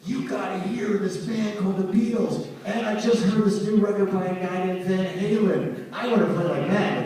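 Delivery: put-on high, eager young student's pitch; excited pleading. Student raving to his elderly Russian teacher, excited. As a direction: gush about a new discovery, voice lifted high.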